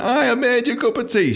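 high, over-eager acted greeting. New worker handing a colleague her tea, over-eager. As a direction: chirp it up high like a keen newcomer.

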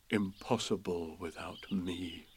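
slow, soft, self-satisfied murmur; quietly pleased with himself; proudly to himself. Acted. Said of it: "said proudly to himself".

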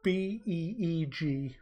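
letters spelled out slowly; spelling each letter slowly. Shown as a demonstration. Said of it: "It's spelled".

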